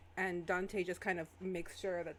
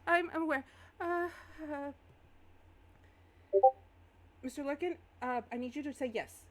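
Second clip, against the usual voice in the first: flustered Iris voice; 7 semitones higher, 5 dB louder than usual. Acted. Game master voices flustered Iris.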